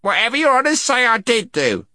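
high-pitched, servile creature voice; squeaky gnome eager to placate captors; cowed gnome. A narrator's reading. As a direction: plead in a high little gnome voice.

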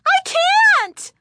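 very high, loud, panicked cry; trembling voice. A narrator's reading. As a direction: cry out in terror. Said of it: "cried in a trembling voice".

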